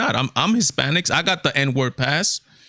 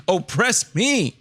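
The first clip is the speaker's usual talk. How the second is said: slow, drawn-out mock begging; sarcastic plea; 5 semitones higher, much slower than usual. Vlogger mocking a millionaire coach, slow sarcastic plea. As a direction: beg to be oppressed, dripping sarcasm.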